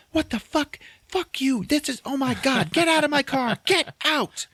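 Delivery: very high, hammed-up furious shriek; outraged yelling. Woman throws him out of car, outraged. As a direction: yell him out in mock outrage.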